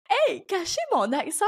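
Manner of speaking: high-pitched, incredulous repeat; mock disbelief. Podcaster recalling early days hiding her accent, mock disbelief. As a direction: exclaim it as if it were absurd.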